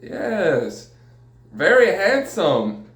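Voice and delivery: high, sing-song imitation of a woman; exaggerated, stretched-out flattery, mimicking her; flattering masseuse. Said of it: "another woman came in who speaks better English".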